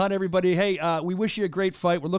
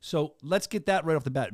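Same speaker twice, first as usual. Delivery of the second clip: usual speaking voice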